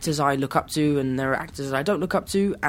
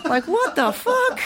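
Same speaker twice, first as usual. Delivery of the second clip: mock disbelief; slower than usual. Mocking own bad scene.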